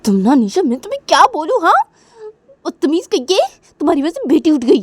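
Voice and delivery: high, loud furious scolding; high, loud, fast angry rebuke. Acted. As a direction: tear into her for waking the baby. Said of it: "गुस्सा हो जाती है निशा के ऊपर".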